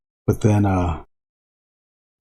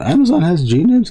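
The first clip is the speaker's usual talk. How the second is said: surprised; 9 semitones higher, 4 dB louder than usual. Real, not acted. Reading a surprising chat message.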